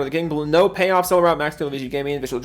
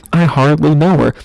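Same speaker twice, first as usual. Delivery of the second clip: louder, punched-out punchline; 8 dB louder than usual. Acted. Streamer cracking the pun on Mariner, loud and deadpan. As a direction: land the joke hard.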